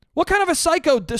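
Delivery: high, fast outrage; baffled outrage, fast; exasperated outburst. Real, not acted. Venting about the doctor, high and fast.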